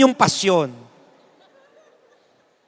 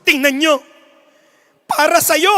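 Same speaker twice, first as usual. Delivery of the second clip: louder, rising appeal; mock-fervent announcer, a little louder; dramatic announcer; 4 semitones higher, 5 dB louder than usual. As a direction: push the emotion at the crowd. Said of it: "Sabi ng announcer".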